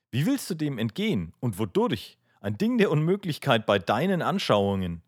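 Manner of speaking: troubled challenge. A narrator's reading. Aljoscha challenging his brother's meaning, troubled and insistent. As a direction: press each phrase upward into a demanding question.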